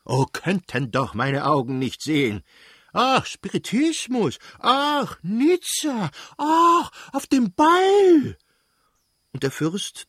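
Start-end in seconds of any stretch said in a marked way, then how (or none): 0.1-8.4 very high, slow, mincing mock of the wife; mocking his wife. The prince mimics his wife's gushing talk, high mocking falsetto. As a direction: squeal like a silly society lady. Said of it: "da er seine Frau nachzuahmen meinte".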